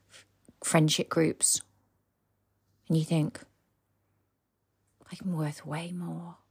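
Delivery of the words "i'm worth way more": a hushed whisper of quiet self-belief; whispered inner thought. Pretend. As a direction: breathe it like a secret promise to yourself. Voicing the listener's inner confidence.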